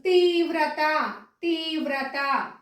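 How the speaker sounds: తీవ్రత with a long tee and plain t; slow, careful beats in తీవ్రత; clear, drawn out. Shown as a demonstration. Teacher dictating the next word, slowly stretched తీవ్రత. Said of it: "పలికేటప్పుడు స్పష్టంగా పలికితేనే".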